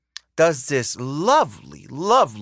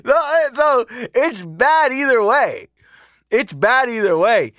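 emphatic; 8 semitones higher, 4 dB louder than usual. Real, not acted. Ranting conclusion.

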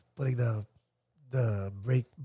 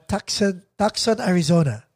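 put-on Filipino-accented "Tucson, Arizona", plain vowels. Caller telling the operator "Tucson, Arizona", unaltered Filipino accent. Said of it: "Maybe I should put the accent".

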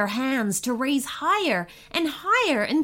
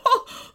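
giant's laugh; 14 semitones higher, 3 dB louder than usual. Acted. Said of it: "That's funny".

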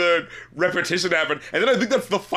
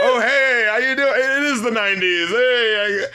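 higher, louder hammed-up greeting; mock greeting; 5 semitones higher, 4 dB louder than usual. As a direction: greet an old acquaintance with mock delight. Mock greeting to the nineties sound.

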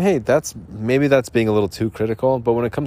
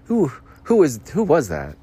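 startled by own snore; 4 semitones higher, 4 dB louder than usual. Retold or imitated. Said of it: "I'm like".

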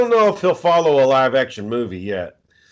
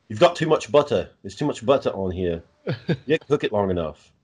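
mock British accent; 4 semitones lower, 4 dB quieter than usual. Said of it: "I can practice on my accents".

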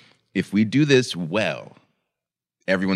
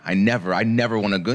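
ordinary delivery

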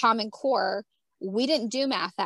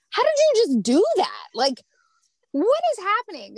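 much higher and louder, astonished; amazed disbelief; 11 semitones higher, 7 dB louder than usual. Retold or imitated. Mom amazed at her husband's calm teaching, astonished cry. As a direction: gasp it out in total disbelief.

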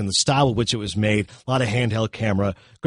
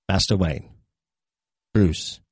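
put-on low, shaky old butler voice; trembling Michael Caine as Alfred; 5 semitones lower, slower than usual. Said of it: "he gets a shaky voice".

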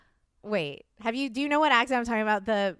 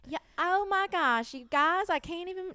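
mock a gushing Southern belle accent; 7 semitones higher than usual. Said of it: "it's not like that".

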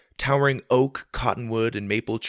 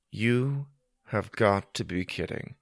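deadpan disbelief; 4 dB quieter than usual. Acted. Freezing boy in snowstorm.

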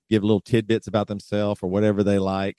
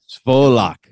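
"Svolok" in a put-on Russian accent, louder; 2 semitones higher, 5 dB louder than usual. Shown as a demonstration. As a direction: bark out "Svolok" Russian style. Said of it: "help me pronounce them right".

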